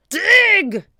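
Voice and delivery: high, loud pirate bellow; shouted order. A narrator's reading. Captain ordering the pirates to dig, shouting. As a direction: bellow the command. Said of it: "shouted Cap'n Bones".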